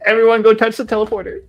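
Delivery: high, loud urgent shout. Real, not acted. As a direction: yell the order to the group. Urgent order in a fight.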